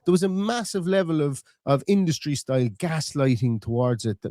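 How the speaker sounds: normal talking voice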